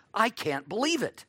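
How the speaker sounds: mock joyful surprise, lightly played; a touch of happy amazement; delighted disbelief. Shown as a demonstration. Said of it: "This is like our expression".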